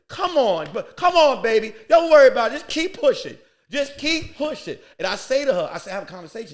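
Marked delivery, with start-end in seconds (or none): 0.1-4.8 encouraging lady. Retold or imitated. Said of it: "This one lady was like this".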